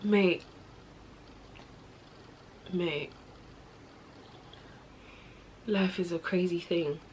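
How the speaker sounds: real quiet, low, drained voice; soft and low, sighed out between long silences; weary, overwhelmed. Real, not acted. Speaker lost for words over racism, weary and overwhelmed.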